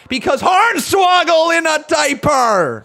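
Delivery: high, loud, slow triumphant yell; punchline shout. Real, not acted. Streamer to chat, revealing the toy's diaper, shouting. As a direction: bellow the gag line slowly. Punchline about Hornswoggle toy.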